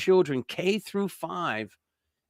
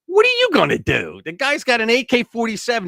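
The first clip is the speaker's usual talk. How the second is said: high, heated rhetorical question; exasperated challenge; 10 semitones higher, 4 dB louder than usual. Real, not acted. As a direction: throw the question out, voice pitched up. Heated argument about the confrontation.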